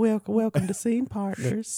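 high, slow cartoon voice; cartoon voice welcoming listeners; Family Guy voice. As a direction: drawl the show name in character. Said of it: "the Family Guy".

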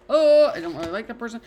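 loud, high dismissive put-on voice; snide aside. Mocking a partner's friend, loudly dismissive. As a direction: sneer it out as someone else.

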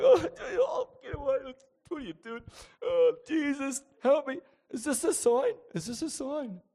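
high, loud put-on wailing; mock crying. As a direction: wail a desperate prayer, hammed up.

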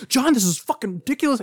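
high-pitched indignant scolding; mock angry outburst. Imitating a friend standing over John pointing down, indignant.

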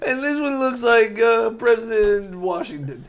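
hammed-up, lilting and slow; silly family member. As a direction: draw it out like an amused relative. Plays another relative in car.

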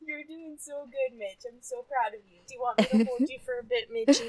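very soft pet-comforting voice; gentle soothing. Real, not acted. Daughter comforting Mitch the dog after thunder, gentle. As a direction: praise and reassure a scared dog.

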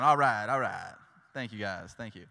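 ordinary talk

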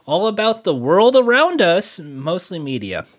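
bright, loud announcer welcome; podcast host intro. Acted. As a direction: open the show like a radio host.

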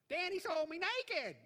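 high, drawn-out wail of a movie line; mock shriek. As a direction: cry it out high, stretching the words. Quoting a line from Caddyshack.

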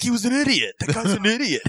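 high-pitched mock protest; defensive boyfriend. Boyfriend justifying the chase to her, mock indignant. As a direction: imitate him excusing himself, voice pushed up high. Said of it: "He's like".

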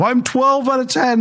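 high, strained voice of a panicking client; panicked client. Retold or imitated. Client crying on the phone.

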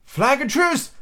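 high-pitched call; cry of surprise. A narrator's reading. Unseen lookout announcing visitors, mild surprised cry. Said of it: "with a cry of surprise".

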